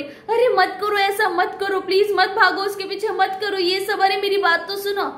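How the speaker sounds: high, wailing mock plea; hammed-up imploring, pitched well up; desperate pleading. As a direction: act out a wife begging him to stop. Wife lying down, begging husband to stop.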